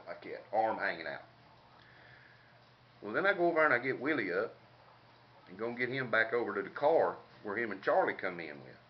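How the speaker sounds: ordinary talk, nothing marked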